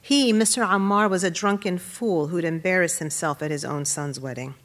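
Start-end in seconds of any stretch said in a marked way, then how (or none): none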